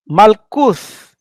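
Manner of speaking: usual speaking voice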